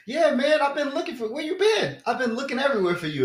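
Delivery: high, loud, hurried greeting; imitated eager stranger. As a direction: mimic someone who finally found his buddy. Imitating stranger who was searching for him.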